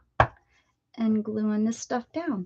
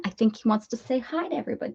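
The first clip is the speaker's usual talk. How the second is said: ordinary talk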